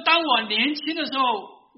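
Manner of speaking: put-on a sudden loud burst as the old professor; loud confession. Old professor confessing, preacher voicing. (忽然大声地说)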